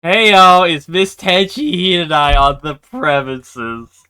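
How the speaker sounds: loud, slow, brassy shinigami calling out; brash shinigami. Acted. Player voicing the shinigami at the door, loud slow call. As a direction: holler at the door like a cocky reaper.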